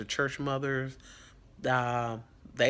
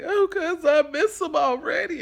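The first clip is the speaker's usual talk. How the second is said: mock weepy self; 9 dB louder than usual. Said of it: "And I'd be like".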